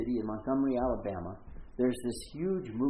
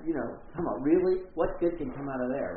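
ordinary delivery

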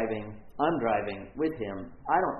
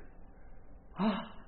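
mock gasp; 8 semitones higher, 6 dB quieter than usual. Reacting to the nurse's diagnosis.

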